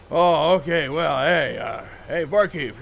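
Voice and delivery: loud tipsy calling out; tipsy holler. Acted. Tipsy guy hollers at barkeep.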